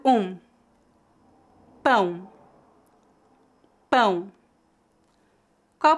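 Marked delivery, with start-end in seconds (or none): 1.8-4.3 Pão with a clear nasal ão diphthong. Shown as a demonstration. Teacher models Pão for learners, nasal diphthong. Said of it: "feita com o ditongo nasal".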